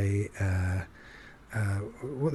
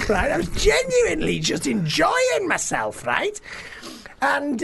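very high, loud Scouse; Scouse accent; 4 dB louder than usual. Acted. [name] telling girlfriend he loves his new life, high Scouse accent. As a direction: exclaim in exuberant, high-pitched Scouse. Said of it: "Jenny was enjoying it too".

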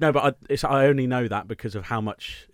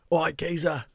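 cheeky London Cockney greeting; a chirpy Cockney geezer; Cockney accent; 5 semitones higher, slower than usual. Pretend. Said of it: "London, Cockney".

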